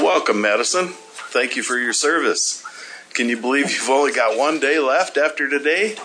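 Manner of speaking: ordinary talk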